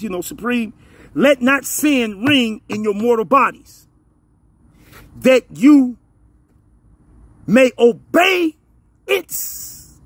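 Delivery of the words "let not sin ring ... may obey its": emphatic scripture with long pauses; slow reading. Real, not acted. Preacher quoting Romans to his listeners, slowly and deliberately. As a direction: break the verse into weighty pieces. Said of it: "I'm gonna read it real slowly".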